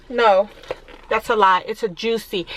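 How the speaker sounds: ordinary talk, nothing marked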